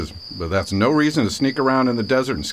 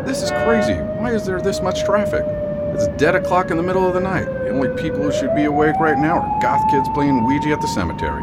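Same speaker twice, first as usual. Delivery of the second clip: exasperated griping; 8 semitones higher than usual. Acted. Sheriff griping in traffic.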